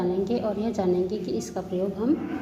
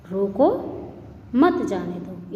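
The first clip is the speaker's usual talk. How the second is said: "रोको", long beat, then a slow "मत जाने दो"; pause after रोको; 2 semitones lower, slower than usual. Shown as a demonstration. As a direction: separate "रोको" from "मत जाने दो" with silence. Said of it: "यहाँ पे हम यहाँ पे विराम लेंगे". ("रोको" पर रुककर, धीरे से "मत जाने दो")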